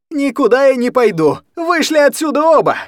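very high, loud refusal; furious shout. Acted. Anya refusing to go to the kitchen, furious shout. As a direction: shout them both out.

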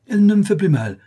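high, loud, hasty protest; alarmed cry. A narrator's reading. Boy denies toothache to avoid extraction, alarmed cry. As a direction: insist in panic. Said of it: "s'écria Tom".

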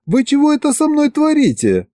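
high-pitched outraged question; indignant wife. A narrator's reading. Wife confronting sorcerers who meddled with her, indignant.

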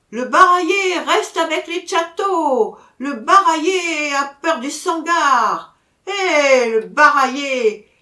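loud, high drawn-out heckling shout; crowd jeering in chorus. A narrator's reading. Crowd heckles the raseteur in the ring, loud high shout. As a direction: call out like a taunting arena crowd. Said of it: "cria la foule faisant chorus".

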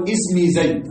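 اسمي said "ismi", opening on an i; ismi with kasra. Shown as a demonstration. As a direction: pronounce اسمي with initial i, "ismi zayd". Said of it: "بالكسر وهذا الأصل".

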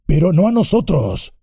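high, angry orc voice; shrill orc protest; northern orc. A narrator's reading. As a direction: goblin from the mines objecting. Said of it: "dijo una de las voces anteriores".

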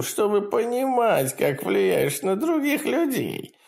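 high, gleeful imp voice; cackling hell torturer. Acted. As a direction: taunt him with cruel glee. Demon taunts.

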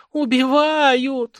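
high, slow drawn-out wail; wailing cry. A narrator's reading. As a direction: wail it out in panic. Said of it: "заголосила Светка". (протяжный высокий вопль)

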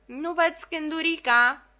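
slow, high call from the back of the hall; shouted from the back. Retold or imitated. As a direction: yell it across the theatre, drawn out. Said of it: "îi strigam".

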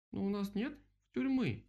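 high little animal voice; objecting vole. A narrator's reading. Vole tells the others there is no jail, piping up.

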